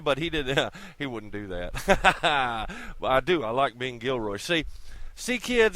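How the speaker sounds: ordinary talk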